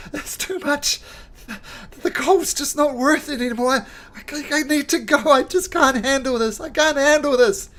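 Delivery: high, loud tearful pleading; hammed-up sobbing soldier. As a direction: sob out the words, breaking down. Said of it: "he's sobbing away".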